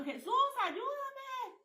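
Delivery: high, slow, stretched-out plea to Jesus; retold cry for help. Woman retells begging Jesus for help, retold high cry. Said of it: "cuando le dije, Ayuda".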